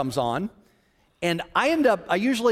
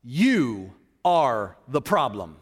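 voice of the Holy Spirit; 6 semitones lower, much slower than usual. Retold or imitated. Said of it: "the Holy Spirit spoke again and said".

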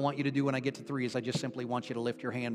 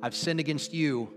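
loud and strong prayer; 2 semitones higher than usual. Real, not acted. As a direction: lead the salvation prayer firmly, leaving pauses to repeat. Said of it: "say this with me loud and strong".

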